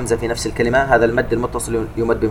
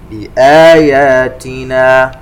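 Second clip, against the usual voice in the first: slow, melodic tajweed chant; measured Quranic recitation; 2 semitones higher, 8 dB louder, much slower than usual. Shown as a demonstration. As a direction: recite slowly with held nasal sounds. Recites verse word.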